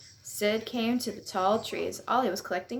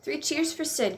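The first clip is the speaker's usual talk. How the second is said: understated cheer, pitched higher; mild cheer; 6 semitones higher than usual. A narrator's reading. Friends cheering their rescuer Sid, mildly raised cheer. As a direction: read a group's cheer with a lift, not a shout. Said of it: "cried the others".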